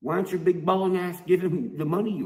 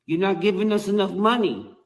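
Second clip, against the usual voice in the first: louder, higher mocking whine; mock rival complaint; 6 semitones higher, 6 dB louder than usual. Rapper mocking rivals' money complaint, loud whiny mock. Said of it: "How they gonna tell you".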